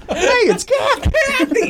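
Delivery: very high, drawn-out cheerful hello; high excited greeting. Shown as a demonstration. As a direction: sing it out high and slow. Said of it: "I should start answering the phone like that".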